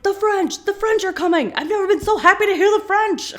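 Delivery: high, loud mock jubilation; hammed-up elated outcry; excited cheering. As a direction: shout it overjoyed, way up in pitch. [name] rejoices at hearing French.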